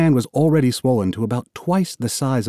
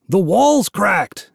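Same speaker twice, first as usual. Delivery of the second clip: slow, higher shouted warning; shouted back; 8 semitones higher, 3 dB louder, much slower than usual. Acted. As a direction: yell the alarm back. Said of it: "Amos shouted back".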